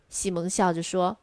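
usual speaking voice

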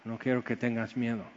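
soft, gentle voice of comfort; tender reassurance. Acted. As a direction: say it quietly, like God calming a frightened child. God speaking gently to us. (suave y tierno)

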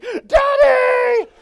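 a high, frantic shout of daddy; panicked scream. Retold or imitated. Frightened son screaming for his daddy in rising surf, a high panicked shout. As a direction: scream it like a scared child in big waves.

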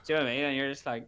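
normal talking voice

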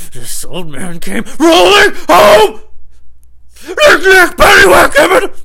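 frenzied high shriek, rising; hammed-up maniac scream; screamed nursery rhyme. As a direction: explode into a crazed screaming chant.

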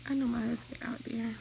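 whispered aside. Real, not acted. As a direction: drop to a whisper. Whispered aside about husband.

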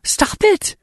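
very high desperate scream; piercing, high-pitched cry; yell. A narrator's reading. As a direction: yell to halt an attack. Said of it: "I yell at him".

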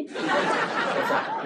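high real laugh breaking in; laughing at the memory. Real, not acted.

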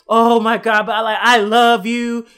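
lightly voiced pleading boyfriend; a hint of simping plea; simping guy. Boyfriend pleading to keep both girls, lightly voiced simp. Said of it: "he's just like".

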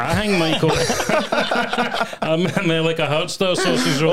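amused, laugh breaking into the words. Real, not acted. Guest joking with the hosts about a sausage roll, laughing.

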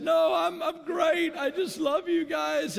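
high, slow, beaming cry of joy; almost sing it out in joy; overjoyed. Retold or imitated. Son insists to worried parents he's great, overjoyed and high.

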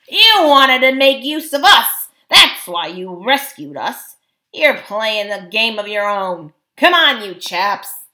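loud, scornful dwarf leader; accuse, then rally the troops; gruff dwarf at full voice; accusing dwarf. A narrator's reading.

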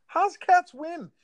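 high, loud, drawn-out challenge; stretched, high-pitched incredulous question. Real, not acted. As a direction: protest in disbelief, voice jumping up.

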